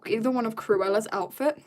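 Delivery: usual speaking voice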